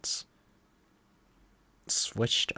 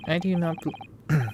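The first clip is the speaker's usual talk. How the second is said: higher-pitched mock Russian accent; 9 semitones higher than usual. Player voicing the Russian captor with a Russian accent. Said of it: "That accent? Eastern European?".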